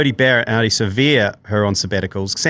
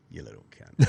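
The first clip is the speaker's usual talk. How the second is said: hushed whisper, much softer and lower; 9 semitones lower, 7 dB quieter than usual. Retold or imitated. Coach whispering his triumph at the reporter. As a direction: breathe it like a secret insult. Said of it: "he's just whispering".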